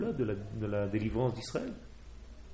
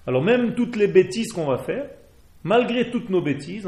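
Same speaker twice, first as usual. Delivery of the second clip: raised, higher voice pressing the point; emphatic preaching; 7 semitones higher, 8 dB louder than usual. Real, not acted. Rabbi urging his listeners, emphatic and louder. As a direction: lift it and hammer the point home.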